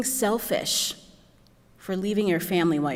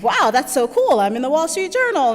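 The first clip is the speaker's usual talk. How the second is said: excited; 7 semitones higher, 7 dB louder than usual. Retold or imitated. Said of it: "I was like".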